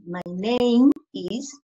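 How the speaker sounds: very slow, drawn-out "my name is"; slow pronunciation model. Shown as a demonstration. Teacher modeling the answer's pronunciation, very slow. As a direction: stretch out "my name is" slowly for the pupils. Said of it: "my name is the pronunciation".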